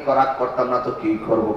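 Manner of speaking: low, grumbling uncle; angry uncle. Retold or imitated. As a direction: answer back low and sore. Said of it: "ভাগিনা আরো গরম".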